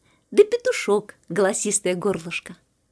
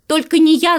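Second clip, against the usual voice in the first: loud, raised-pitch refusal; shouted refusal; 5 semitones higher, 7 dB louder than usual. A narrator's reading. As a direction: cry out that it won't be you. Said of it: "закричал Верть". (громко выкрикивает отказ)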